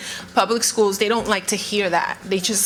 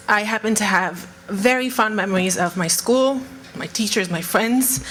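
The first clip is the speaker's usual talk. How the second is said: ordinary talk, nothing marked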